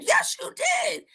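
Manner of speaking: drawn-out high shout of denial; retold insistent yell. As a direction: mimic the son shouting back at the teacher. Son insisting teacher lied.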